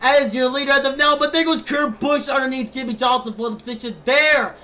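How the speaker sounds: shouting, excited live play-by-play; race commentator. Acted. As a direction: call the race at full hype.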